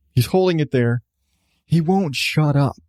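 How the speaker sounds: ordinary delivery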